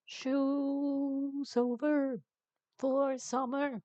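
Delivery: very slow stretched sing-song; slow teasing drawl; drawn out. Real, not acted. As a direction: stretch each word out playfully. Drawn out silly sign-off.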